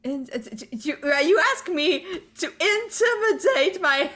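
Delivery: loud, high, stammering disbelief; mock indignation. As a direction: splutter it out, hammed-up and incredulous. Player balks at intimidating, mock indignant.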